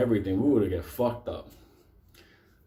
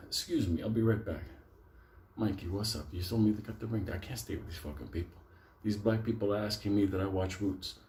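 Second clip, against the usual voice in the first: hushed aside; 3 semitones lower, 8 dB quieter than usual. Retold or imitated.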